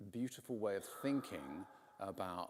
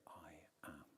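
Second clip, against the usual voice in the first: hushed whisper; 3 semitones lower, 12 dB quieter than usual. Shown as a demonstration. Preacher voicing Jesus' divine name, whispered. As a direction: drop to an unvoiced whisper on I am.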